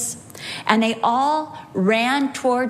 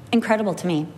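ordinary delivery

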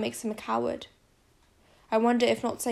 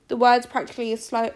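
ordinary delivery

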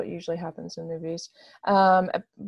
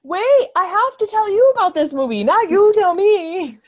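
much higher and louder, playfully insistent; loud excited protest; 11 semitones higher, 10 dB louder than usual. Real, not acted. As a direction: cut in, pitched way up and loud. Cuts in to take over.

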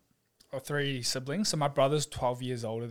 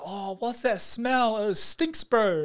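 put-on high, slow, sneering kid voice; mock the smell like a teasing classmate; mocking schoolkids; 8 semitones higher, 5 dB louder, slower than usual. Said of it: "kids at school being like".

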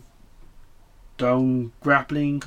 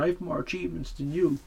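mocking a bratty twelve-year-old, softer; whiny gamer kid; 3 semitones higher, 6 dB quieter than usual. Mocking a twelve-year-old PlayStation gamer bragging to Nia Jax, bratty whine. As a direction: sneer it like a kid gloating over achievements. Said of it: "some fucking twelve-year-old on the PlayStation going".

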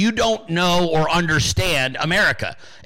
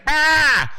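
high-pitched imitated old man's yell; short crabby yell, mimicked; hammed-up cry of outrage; mock yell; 10 semitones higher, 4 dB louder than usual. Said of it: "Then Stuart starts yelling".

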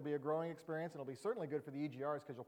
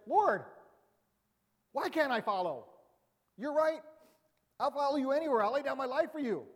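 eager Peter; 8 semitones higher, 6 dB louder than usual. Retold or imitated. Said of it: "And then Peter says".

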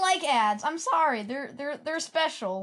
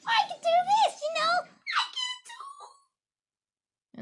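extremely high squeaky falsetto; high trick voice; much slower than usual. Shown as a demonstration. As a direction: show off how high the voice goes. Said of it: "I can make different types of voices".

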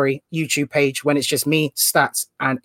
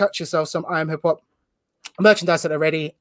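usual speaking voice

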